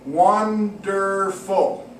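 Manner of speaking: "Wonderful" very slow, "Won-der-ful"; slow syllable split. Shown as a demonstration. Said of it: "try and clap where you think it would work".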